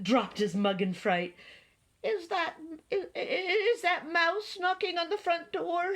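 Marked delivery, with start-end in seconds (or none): 2.0-5.9 high, stuttering, frightened rabbit; fearful stammer. A narrator's reading. Rabbit asking who is knocking, fearful stammer. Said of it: "he whispered".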